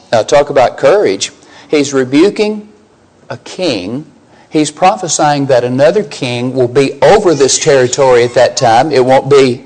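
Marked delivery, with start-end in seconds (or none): none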